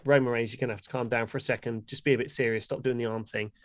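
quietly telling someone to settle; hushed scolding. Acted. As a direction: hush them down, half serious. Telling Roman Reigns to calm down.

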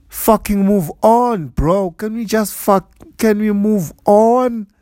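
exasperated, slow and loud; frustrated shouting. Real, not acted. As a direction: yell it out in frustration. Ranting at people without masks.

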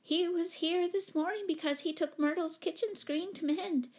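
high-pitched, fluttery explanation; fussy chatty lady. A narrator's reading. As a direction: prattle it in a high voice.

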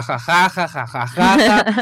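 mock "ha ha ha", drawn out; exaggerated pretend laughing; imitating group laughter. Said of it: "todos estuvimos ja".

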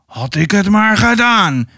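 mock bellow of an angry mason; understated roar. A narrator's reading. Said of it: "brulde Nicola".

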